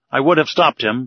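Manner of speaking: ordinary delivery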